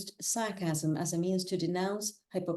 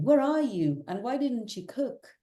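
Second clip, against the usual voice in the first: raised, higher voice imitating an angry boyfriend; mock shouting; 5 semitones higher, 3 dB louder than usual. Presenter voicing a cartoon boyfriend phoning his girlfriend, mock shouting. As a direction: act out a demanding shout down the phone. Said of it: "the boyfriend shouting down the phone".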